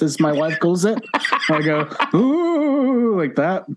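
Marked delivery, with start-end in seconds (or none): 2.1-3.2 high ghostly ooh; spooky ghost moan. Shown as a demonstration. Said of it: "I may sound like a, a spooky ghost".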